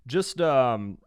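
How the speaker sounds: normal talking voice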